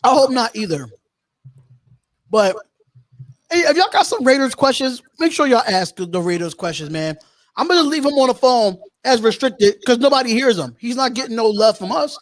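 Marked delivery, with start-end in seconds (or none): none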